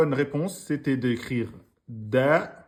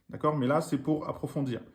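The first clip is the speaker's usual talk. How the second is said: ordinary talk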